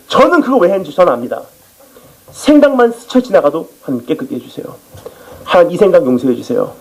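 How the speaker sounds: ordinary talk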